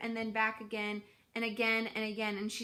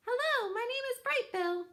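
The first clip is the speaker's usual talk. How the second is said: much higher, small cheerful gosling voice; little gosling voice; 14 semitones higher than usual. Acted. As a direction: chirp it like a tiny gosling. Gosling greets the squirrel.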